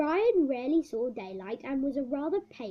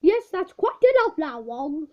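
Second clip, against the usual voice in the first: louder, higher irritated warning; 4 semitones higher, 8 dB louder than usual. Acted. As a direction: warn him to stop, losing patience. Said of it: "was becoming irritated".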